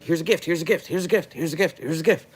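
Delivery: high, excited voice for the chat, each line rising; mock-excited viewer voice; eager gift-giving viewers. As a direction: chirp it like an eager fan sending gifts over and over. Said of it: "people are constantly like".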